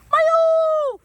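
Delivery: high, loud call across the park. Real, not acted. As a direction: shout the dog's name to call him back. Calling dog from afar.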